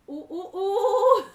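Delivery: mock puzzled. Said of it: "Aí você fala".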